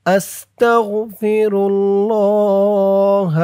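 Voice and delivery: drawn-out Arabic recitation of আস্তাগফিরুল্লাহ, each sound held; slow Quranic-style recitation of আস্তাগফিরুল্লাহ. Shown as a demonstration. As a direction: chant আস্তাগফিরুল্লাহ slowly for the class to copy. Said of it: "এখন আপনি একবার মিলিয়ে নিবেন".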